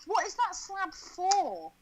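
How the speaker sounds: high, slow mock outrage; exaggerated disbelief, drawn out; incredulous. Real, not acted.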